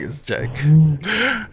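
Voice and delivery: "it's a joke" stretched very slowly; drawn-out reassurance. Real, not acted. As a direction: drag out "it's a joke" word by word.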